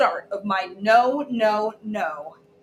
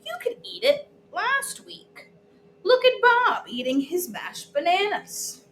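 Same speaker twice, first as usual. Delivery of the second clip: Mommy voice; 9 semitones higher than usual. Acted. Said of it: "And Mommy said".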